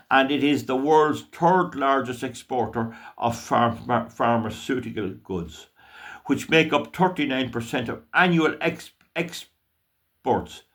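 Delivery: halting read-out, stumbling on long words; real stumble while reading aloud; tongue-tied. Real, not acted. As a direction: read slowly, tripping and restarting mid-word. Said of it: "I'm getting a bit tongue-tied".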